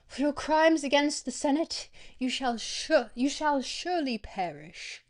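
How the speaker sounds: hammed-up British orator passing sentence; British accent. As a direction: pronounce doom in a grand British voice. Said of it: "I'll narrate it in a British accent".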